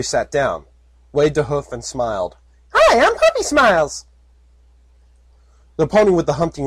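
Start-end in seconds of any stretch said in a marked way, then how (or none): 2.7-4.0 high, bright-eyed filly greeting; cheery filly. Acted. Filly waving to an armed guard, high and cheery. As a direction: chirp it like an eager little filly.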